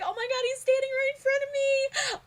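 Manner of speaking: breathless star-struck shriek; starstruck squeal. Retold or imitated. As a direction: relive the moment you spot your idol. Retold starstruck reaction to producer.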